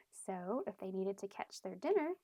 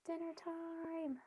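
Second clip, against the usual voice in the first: slow, higher, ominous; dramatic reveal; 8 semitones higher, 4 dB quieter, slower than usual. Real, not acted. Reader teasing children with danger, slow and ominous. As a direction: draw it out slowly for suspense. Suspense for the kids.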